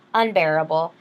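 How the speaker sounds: usual speaking voice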